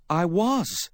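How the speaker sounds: high-pitched insistence; insistent. A narrator's reading. Woman defending her alibi to Sam, insistent. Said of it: "she insisted".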